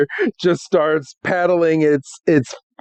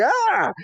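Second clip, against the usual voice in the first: mock scream; 3 dB quieter than usual. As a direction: yelp as if yanked off your feet. Imitates Ash being yanked into water.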